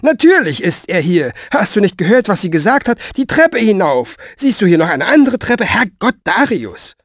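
high, loud-edged impatient scolding; indignant, high-pitched tirade; exasperated bossy woman. Acted.

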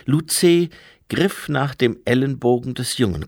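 usual speaking voice

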